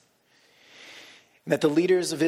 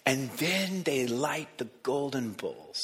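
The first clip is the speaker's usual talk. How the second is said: slow whisper of awe; slow down and whisper the moment; hushed narration; 4 dB quieter, slower than usual. Acted.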